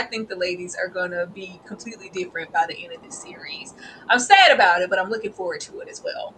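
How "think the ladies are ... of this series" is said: hushed whisper, barely voiced. Real, not acted. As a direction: let it drop to a secret whisper. Confiding aside to listeners.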